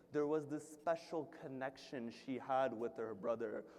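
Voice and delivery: low, very soft solemn preaching; subdued mourning recital. Acted. Speaker opens the tragedy for mourners, low and subdued. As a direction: sink into a hushed, grave telling.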